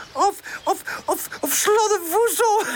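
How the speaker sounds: high, stammering giggly cry; high-pitched, helpless storybook laughter; calling out, laughing. A narrator's reading. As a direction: splutter the joke and crack up. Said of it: "roept Charlie".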